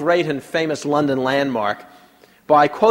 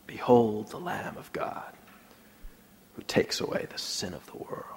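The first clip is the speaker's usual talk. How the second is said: hushed, breathy whisper, much softer; 3 semitones lower, 9 dB quieter than usual. Shown as a demonstration. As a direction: whisper it to test the room. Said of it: "he thought he'd try a whisper".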